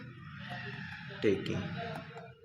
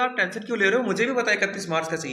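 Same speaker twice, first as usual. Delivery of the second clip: brushing off auditor; 7 semitones higher, faster than usual. Retold or imitated. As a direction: wave away the worry like a slick auditor. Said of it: "वो बोलेगा".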